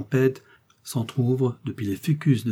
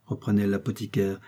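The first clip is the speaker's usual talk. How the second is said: ordinary delivery, nothing marked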